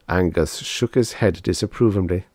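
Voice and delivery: ordinary delivery, nothing marked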